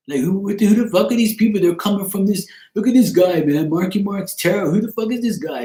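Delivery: high, riled-up imitation of his accent; mock his accent, high and indignant; mock accent impression. Mimicking a man griping about clout chasers, mock accent. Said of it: "His, his real accent, he was like".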